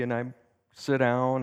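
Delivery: ordinary talk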